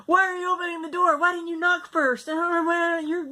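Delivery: high, loud snapping at husband; angry outburst. Retold or imitated. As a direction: bite his head off, loud and high. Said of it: "I bit his head off".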